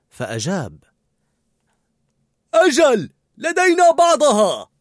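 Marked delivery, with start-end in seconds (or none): 2.5-4.7 very high, loud shouted reply; calling back. A narrator's reading. Cook answering a colleague's question, loud calling back. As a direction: holler the answer back, drawing out the end.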